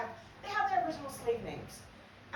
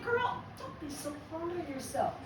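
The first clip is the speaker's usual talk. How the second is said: sly compliment; 6 semitones higher, 6 dB louder than usual. Retold or imitated. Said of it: "I said".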